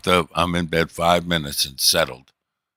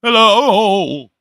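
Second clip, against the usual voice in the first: much higher and louder pleading call; second hello, pushed higher and louder; desperate louder hello; 9 dB louder than usual. Retold or imitated. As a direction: shout it out into an empty world. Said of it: "all desperate 'cause no one else is left".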